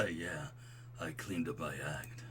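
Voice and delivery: low, laid-back bro voice; smooth and buttery. Acted. Old college friend answering a compliment, smooth low voice. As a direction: keep it deep and mellow. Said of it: "I can do smooth and buttery".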